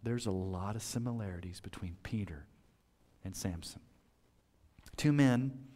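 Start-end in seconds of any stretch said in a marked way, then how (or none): 0.0-3.8 hushed, half-whispered musing; a soft aside to himself; inner thought. Retold or imitated. Preacher recalling his own thought, whispered. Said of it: "I thought".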